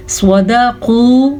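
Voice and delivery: slow, drawn-out Quran recitation; tajweed recitation. Shown as a demonstration. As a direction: chant it slowly, stretching the vowels. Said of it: "صاد کو پر پڑھنا ہے". (تجوید کے ساتھ آہستہ تلاوت)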